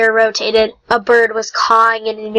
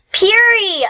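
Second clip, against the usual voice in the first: sharp yelp jumping much higher; 10 semitones higher than usual. Acted. Young fox yelps at his pouncing brother, high yelp. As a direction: yelp the name as claws catch you. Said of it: "I yelped".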